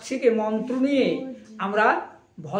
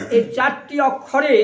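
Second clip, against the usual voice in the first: ordinary delivery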